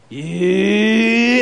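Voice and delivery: long rising karate cry; drawn-out attack yell; Mister Miyagi. Retold or imitated. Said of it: "Mister Miyagi gets really intense".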